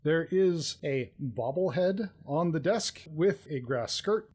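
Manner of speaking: ordinary delivery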